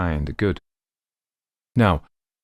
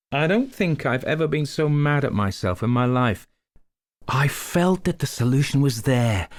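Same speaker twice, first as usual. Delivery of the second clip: put-on Maigret read in a higher American accent; lightly American-sounding detective; 8 semitones higher than usual. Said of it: "I don't know why I did it in that accent".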